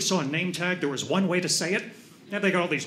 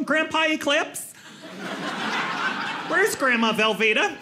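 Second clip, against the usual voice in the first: higher, lightly voiced little kid; grandchild; 7 semitones higher than usual. Grandkid going up to oddly named grandpa, lightly mimicked child. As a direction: ask it like a puzzled small grandkid. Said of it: "their kids are gonna go up to 'em".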